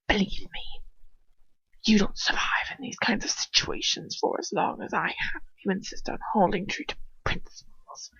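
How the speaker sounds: soft, unvoiced menacing whisper; hushed, sinister whisper; cold whisper. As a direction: hiss it quietly. Villain's whispered lesson.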